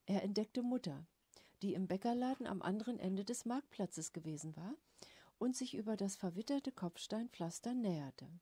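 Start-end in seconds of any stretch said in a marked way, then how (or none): none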